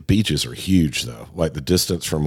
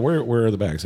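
a higher, lightly mimicked shop attendant asking; mock the attendant's questioning tone, a bit higher; imitating the attendant; 6 semitones higher than usual. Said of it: "Kinda like that".

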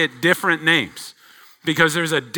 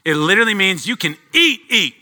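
"eat, eat" repeated, fast and higher; doubled for emphasis; 6 semitones higher, 5 dB louder, faster than usual. Shown as a demonstration. Preacher showing the emphatic Hebrew, quick and high. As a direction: say "eat" twice, pushing it. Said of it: "That word surely in Hebrew is so emphatic".

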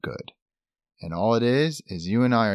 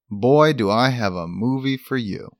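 ordinary talk

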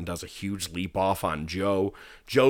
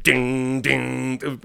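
raised, ringing "ding, ding"; imitated entrance music; 4 semitones higher than usual. Fan imitates Wild Thing starting as the wrestler leaves, ringing dings. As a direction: sound out the opening notes of a theme. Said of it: "before you hear".

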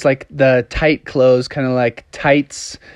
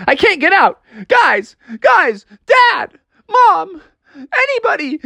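high shouts climbing in pitch; panicked calling. Acted. Froggy calls his family from the locked bathroom, panicking. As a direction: cry out for anyone to come. Trapped frog calls for help.

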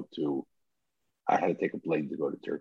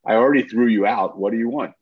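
raised, louder retold rebuke; angry rabbi; 5 semitones higher, 10 dB louder than usual. A rabbi rebuking a pushy young visitor, loud and annoyed. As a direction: bark it back like an irritated old rabbi.

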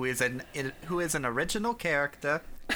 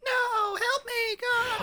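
very high wailing plea; mock cry for help; slower than usual. Friend piling on the damsel bit, high mock wail. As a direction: shriek for rescue, hammed up.

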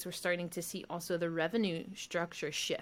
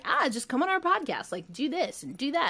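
breezy, higher mock-casual voice; tossing it off like it's no big deal; light imitation of easygoing recruiters; quoting the college team; 6 semitones higher than usual. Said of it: "they were like".

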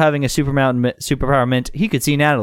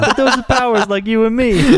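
high, dopey mock New Yorker; New Yorker accent; 9 semitones higher, 3 dB louder than usual. Dumb Jay explaining powers, high New Yorker voice. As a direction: exaggerate a bad New York accent. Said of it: "like a New Yorker accent".

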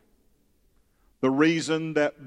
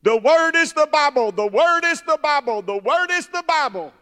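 high, loud screamed chant, imitating a little child; yelling toddler preacher; 13 semitones higher, 8 dB louder than usual. Father imitating little son with a vacuum-hose microphone, yelling and screaming. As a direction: mimic a tiny boy preaching at full yell. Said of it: "he'd yell and scream".

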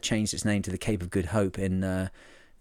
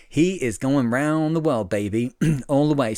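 excited; 3 semitones higher than usual. Real, not acted. Playful cheer about sailor's voyage.

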